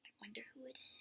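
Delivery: quick hushed whisper; suspense whisper. Shown as a demonstration. Reader whispering to children before the reveal. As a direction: whisper it like a secret. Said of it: "aside to listeners, building suspense".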